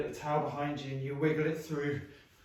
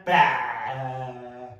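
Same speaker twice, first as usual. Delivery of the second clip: mouth-open bah; 4 semitones lower, 4 dB louder than usual. Shown as a demonstration. Teacher showing kids an empty mouth, a loud bah. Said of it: "Check there's nothing there".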